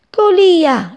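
high, loud cry of the name; shout. A narrator's reading. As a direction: call out in startled joy. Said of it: "gridò Pelle di Luna".

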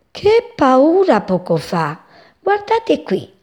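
high, slow at first, then a sudden squeak; excited sparrow. A narrator's reading. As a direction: boast about the scare. Passero racconta la sua avventura. (passerotto emozionato, voce acuta)

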